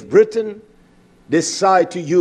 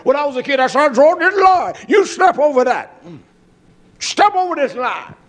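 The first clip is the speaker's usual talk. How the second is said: high, loud taunting bully; mock schoolyard bully challenge; 8 semitones higher, 4 dB louder than usual. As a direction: dare him across the line. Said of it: "a bully saying".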